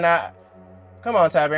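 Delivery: high, loud nah come on; shocked disbelief. Retold or imitated. Viewer protests to Tabby, high and loud in shock. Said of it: "I was shocked".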